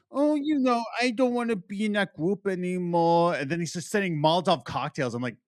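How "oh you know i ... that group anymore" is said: higher, put-on whiny character voice; mock the character's excuse; lightly voiced sulky teen; whiny Ren. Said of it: "It's like".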